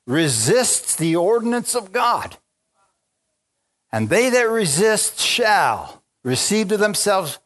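rising preacher's fire; fervent scripture read. Real, not acted. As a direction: lean in hard and draw each word out. Preacher reading scripture with fervor.

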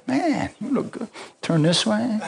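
high-pitched mock self-flattery; vain self-admirer. Mimes admiring oneself in mirror.

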